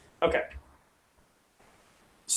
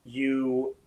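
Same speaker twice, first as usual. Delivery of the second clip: ominous scene setting; 2 semitones lower than usual. Acted. Game master sets the scene.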